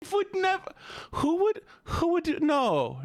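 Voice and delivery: very high, flustered protest; flustered denial. Pretend. Streamer hastily denies it to Keeper, flustered squeal.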